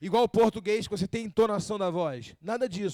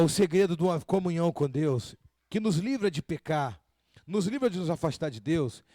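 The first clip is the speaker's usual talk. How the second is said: ordinary delivery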